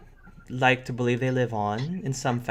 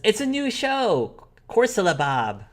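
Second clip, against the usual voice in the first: put-on high-pitched child answering; little sister; 12 semitones higher, 4 dB louder than usual. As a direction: announce a show like a proud child.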